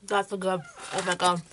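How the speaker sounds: chewing while talking; speaking around food; mouth full. Real, not acted. Seen 0: Bit bar at -5; mouth working on bite while speaking.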